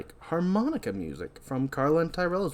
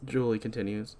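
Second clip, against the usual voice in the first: usual speaking voice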